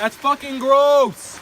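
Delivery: slow, loud and high; disgusted shout. Real, not acted. Friend will drink creek water.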